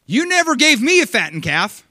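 loud, high aggrieved complaint; mock indignant older brother; complaining son. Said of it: "And he's like".

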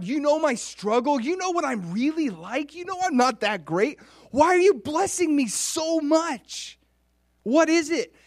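high, loud, insistent questions; bewildered pleading. Acted. David asking God why he is blessed, bewildered cry. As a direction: build to an incredulous cry.

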